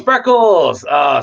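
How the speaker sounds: sudden high, loud delight at a pet's picture; delighted recognition. Real, not acted. Recognizing beloved cat photo.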